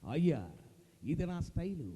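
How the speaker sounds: low, swaggering star delivery; Rajinikanth impression. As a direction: toss it off with style. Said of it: "super star Rajinikanth గారు ఇట్లే వస్తున్నారు".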